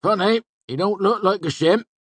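high, loud rustic character voice; amused villager, pitched well above the narrator; village tavern regular. A narrator's reading.

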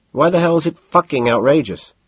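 angry challenge. A narrator's reading. Price challenges Bateman.

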